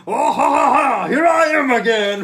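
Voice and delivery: shrill, very loud, cackling genie return; gleeful genie. Pretend. Host mocks Eddie returning as genie, shouting with laughter. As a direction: burst out of the lock laughing like a manic genie. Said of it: "like a genie".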